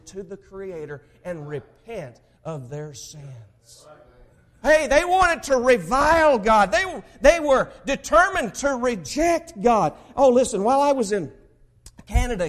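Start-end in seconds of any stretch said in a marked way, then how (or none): 4.6-12.5 fiery preacher, higher and louder; preaching. Acted. Preacher rousing his congregation, fiery and loud. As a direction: thunder it at the congregation.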